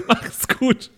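normal talking voice

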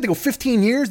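ordinary delivery

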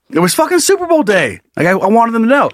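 high, pumped-up emphasis on the big day; hyped about game day; excited. Real, not acted. Emphatic retelling of his plan.

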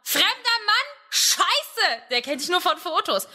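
high, hammed-up alarm; mock panic about meeting a stranger; panicked inner voice. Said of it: "das klingt ungefähr so".

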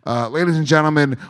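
normal talking voice

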